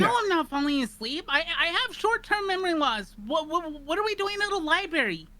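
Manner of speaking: frustrated character snapping back; defensive outburst. Acted. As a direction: protest being accused of dozing. Eddie defends himself, agitated.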